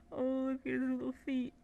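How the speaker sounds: adoring. Real, not acted. Cooing over tiny plush.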